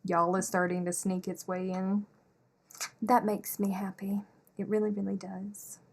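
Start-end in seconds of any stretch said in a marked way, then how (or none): 3.0-5.8 whispered aside. Real, not acted. Happy aside to viewers.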